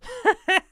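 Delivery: high chuckle with a sob; throaty chuckle. Acted. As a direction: laugh through tears, hammed up. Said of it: "throaty chuckle mixed with the sob".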